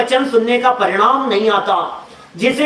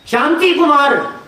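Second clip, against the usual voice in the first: higher, drawn-out, irritated name; तेज़ी में, चिढ़कर; 4 semitones higher, slower than usual. Acted. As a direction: act losing patience at being asked repeatedly. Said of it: "तो थोड़ा तेजी में बोलता है". (चिढ़कर ऊँचे सुर में)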